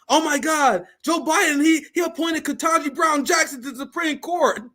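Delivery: high-pitched, excited mock-liberal voice; mock gushing liberal. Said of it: "What liberals talk about is".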